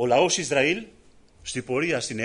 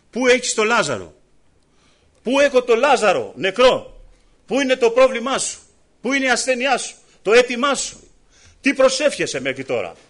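higher, louder searching questions; fervent preaching; 7 semitones higher, 6 dB louder than usual. Acted. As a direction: press the congregation with each question. Preacher voicing the Lord's question. (ένθερμο κήρυγμα με δυνατές ερωτήσεις)

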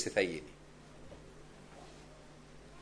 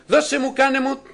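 demanding whine; 12 semitones higher, 8 dB louder than usual. Retold or imitated. Mimicking demanding prayers.

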